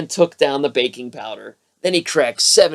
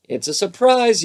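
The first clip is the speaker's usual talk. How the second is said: mild yell; 7 semitones higher than usual. A narrator's reading. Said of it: "yelled Froggy".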